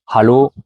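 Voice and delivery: "Hallo" avec un H bien soufflé au début; H prononcé. Shown as a demonstration. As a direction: attaque "Hallo" en expirant le H. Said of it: "il faut essayer de bien le prononcer". ("Hallo" avec le H aspiré)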